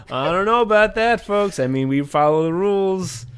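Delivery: mock rally bluster; Trump. Politician distancing himself before his crowd, high impression. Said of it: "when he's like".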